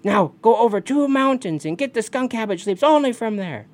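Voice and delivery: high, loud orders; high-pitched commanding call; bossy Raven. Acted. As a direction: send the youngsters off firmly. Raven orders the birds away.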